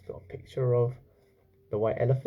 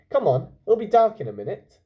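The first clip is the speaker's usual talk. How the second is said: put-on higher, louder urging boy; 8 semitones higher, 7 dB louder than usual. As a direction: hurry the elephant along. Chulak urges Hamlet on.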